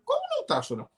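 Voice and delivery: réplica bem aguda e mais baixa; incredulidade encenada. Acted. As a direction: questionar a negação com voz fina e contida. Encena réplica incrédula à negação. (incrédulo, em tom agudo)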